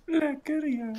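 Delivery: squealing laugh. Real, not acted.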